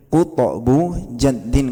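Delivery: "Kutokbu jaddin" slow, in separate chunks; measured teaching recital of "Kutokbu jaddin"; formula recital. Shown as a demonstration. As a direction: pause between the syllable groups of "Kutokbu jaddin". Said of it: "dirangkum dengan rumus".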